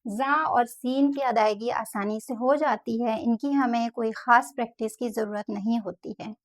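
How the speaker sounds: normal talking voice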